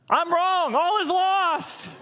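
high, loud drawn-out lament; hammed-up cry of total defeat; mock despair. Martha thinking Jesus has given up, mock despair. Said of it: "what would Martha be thinking".

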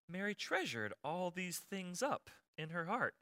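very soft, slow, poetic recitation; gentle, drawn-out Bible reading; reverent scripture reading. Acted. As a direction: hush it and stretch it like sacred verse. Scripture contrasted with blunt paraphrase.

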